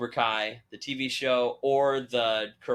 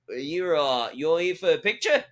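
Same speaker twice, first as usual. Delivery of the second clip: raised, badly faked Australian question; terrible Australian accent; 5 semitones higher, 3 dB louder than usual. Actor asking a fan about a photo, in a bad Australian accent. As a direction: ask in a rough mock-Australian accent. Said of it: "I just did a terrible Australian accent".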